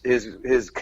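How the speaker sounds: ordinary talk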